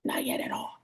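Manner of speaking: soft breathy whisper under her breath; whispered aside, barely audible. Real, not acted. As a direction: hush it like a secret. Aside, hushing about Daisy.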